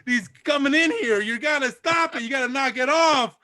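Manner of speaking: loud exasperated host throwing them out; angry maitre d'. Acted. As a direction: yell at the pigs to leave. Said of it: "The maitre d' says".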